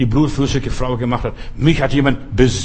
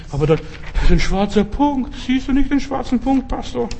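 worried congregant; 10 semitones higher than usual. Acted. Congregant objects to pastor.

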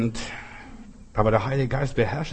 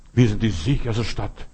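ordinary talk, nothing marked

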